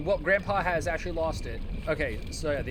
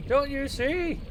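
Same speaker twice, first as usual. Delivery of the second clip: put-on high, slow, loud prophetic old man; 8 semitones higher, 5 dB louder, slower than usual. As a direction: proclaim doom like a crazed grandpa. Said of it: "the old man voice".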